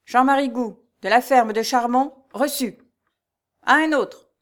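high, loud roll-call announcement; ringing voice. A narrator's reading. Steward announcing the peasant's name to the yard, ringing. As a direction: proclaim it across the courtyard. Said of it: "puis il ajouta d'une voix retentissante".

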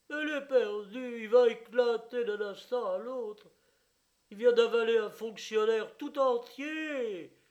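high, loud wailing proclamation; loud lament. Acted. Owner proclaiming his crocodile will burst, high loud wail. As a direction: bewail the dying crocodile to everyone. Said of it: "clamait le propriétaire".

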